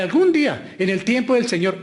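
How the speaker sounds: high, loud parody of putting it off; mocked excuse. Preacher mimics believers excusing unsaved relatives, mocking. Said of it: "no volvamos con el cuento".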